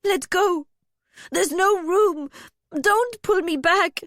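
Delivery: high, loud panicked pleading; begging. A narrator's reading. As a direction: beg frantically to be let go. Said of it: "Alaria begged".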